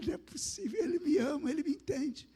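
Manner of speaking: high-pitched, astonished wonder; retold awe at being loved, voice lifted high; overwhelmed disbelief. Retold overwhelmed reply to God's voice. (espanto comovido, voz aguda)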